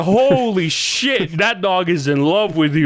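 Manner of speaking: drawn-out, high exclamation with a laugh; amazed. Retold or imitated. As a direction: marvel loudly, cracking up. Acts out amazement at humping dog.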